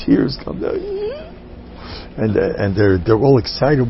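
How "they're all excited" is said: usual speaking voice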